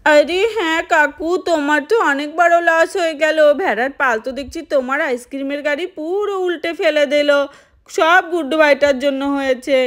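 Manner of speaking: put-on a little child consoling the ice cream man. Child sympathizes with uncle.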